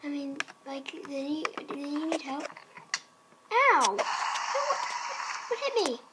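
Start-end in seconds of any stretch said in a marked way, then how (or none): 3.5-4.0 sudden loud "ow"; pained yelp. Pretend. Player struck by something unseen, pained yelp. 4.0-6.0 high, baffled outcry; startled disbelief. Pretend. Player hit by an Enderman, startled and baffled. Hit by unseen thing in game.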